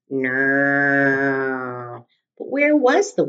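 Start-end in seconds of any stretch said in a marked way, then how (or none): 0.1-2.1 long droning airplane hum; airplane noise. Acted. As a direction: buzz like a toy plane. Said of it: "made an airplane noise".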